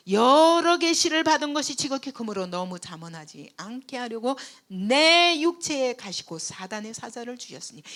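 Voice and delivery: loud, ringing scripture reading; booming pulpit reading; preaching scripture. Acted. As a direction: proclaim the verse to the hall. Reads scripture at full force.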